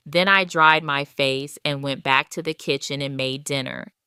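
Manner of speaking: ordinary delivery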